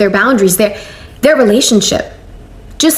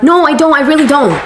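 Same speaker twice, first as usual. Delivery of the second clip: insistent denial; 6 semitones higher than usual. Retold or imitated. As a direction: protest firmly that you mean well. Denying bad intentions to mother-in-law.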